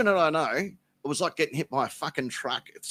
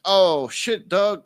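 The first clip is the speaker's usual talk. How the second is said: louder, higher exclaimed "Oh, shit, dog"; hammed-up alarmed exclamation; imitating Kelly; 6 semitones higher, 8 dB louder, much slower than usual. Kelly finding him under the table, louder mock alarm. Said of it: "she's like".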